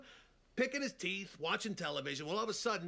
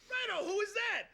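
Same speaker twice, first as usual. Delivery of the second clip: nervous pig. Acted. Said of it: "they go".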